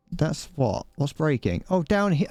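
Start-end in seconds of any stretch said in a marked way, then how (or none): none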